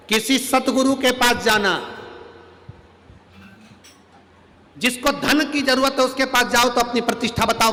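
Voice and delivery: high, loud preaching; preaching at full force to the assembly; fiery preaching. Acted. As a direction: drive the point home to the crowd.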